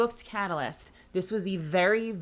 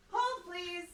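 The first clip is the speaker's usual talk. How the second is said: much higher, softer chirpy TikTok voice; 13 semitones higher, 7 dB quieter than usual. Pretend. Streamer stepping away to put her bath towel away, TikTok voice. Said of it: "Why am I doing the TikTok voice?".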